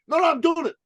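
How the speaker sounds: high, loud stubborn insistence; mock the client digging in his heels. Dan stubborn, voiced high.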